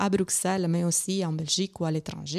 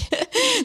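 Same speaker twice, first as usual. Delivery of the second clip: embarrassed laugh; 5 dB louder than usual. Real, not acted. Embarrassed after accent slip.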